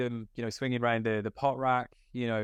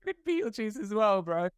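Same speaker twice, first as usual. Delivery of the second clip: excited; 10 semitones higher than usual. Retold or imitated. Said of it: "We're like".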